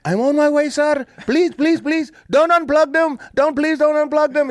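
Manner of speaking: high-pitched, pleading imitation of Deepu; frantic high pleading; begging Deepu impression. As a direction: beg like a panicked guy with a squeaky voice. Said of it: "He's telling me like this".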